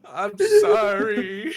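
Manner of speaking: loud, drawn-out fake crying apology; hammed-up child's tearful apology; mock sobbing. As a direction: wail it slowly through laughter, like a bawling kid. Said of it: "hyperventilating, trying to stop crying".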